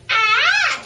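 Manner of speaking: short, high, awkward yelp; awkward scream. Retold or imitated. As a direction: scream like you forgot how. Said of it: "I forgot how to scream".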